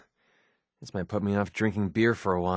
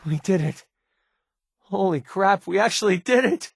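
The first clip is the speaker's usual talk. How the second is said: can't believe the plan worked; excited; 8 semitones higher than usual. Acted. Companion cheering the player after saving Duncan, excited. Celebrating success after a rescue mission.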